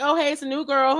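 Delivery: high, bubbly mock welcome; friendly greeter. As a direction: play the cheerful classmate who greets the newcomer. Imagined friendly welcome of new girl.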